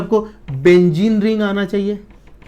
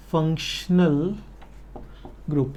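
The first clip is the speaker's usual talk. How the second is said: drawn-out syllables, written-along pace; slow teacher's dictation; 7 dB quieter, slower than usual. Real, not acted. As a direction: say it slowly as if writing it out. Dictating the term while writing.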